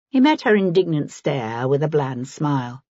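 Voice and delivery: plain reading voice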